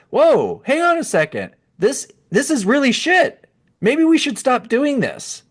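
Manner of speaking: high, alarmed mock realization; fed-up Americans.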